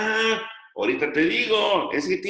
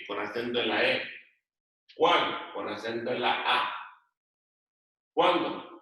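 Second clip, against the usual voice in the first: each word lifted, then its spelling note lower; classroom dictation; 3 semitones lower than usual. Real, not acted. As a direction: dictate a word list item by item, pausing for copying. Teacher dictates word list.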